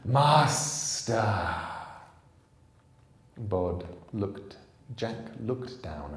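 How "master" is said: longing, delighted call of master; a creature's happy sigh of devotion. A narrator's reading. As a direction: savour the word with longing. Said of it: "with a sigh of delight and of longing".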